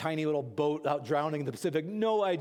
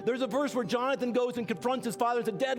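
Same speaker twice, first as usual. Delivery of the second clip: raised, pressing pulpit exhortation; preaching at full force; 4 semitones higher than usual. Acted. Pastor urging church to find covenant friends, preaching hard. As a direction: push the congregation to act, higher.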